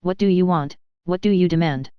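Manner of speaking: ordinary delivery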